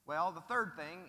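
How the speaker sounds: ordinary delivery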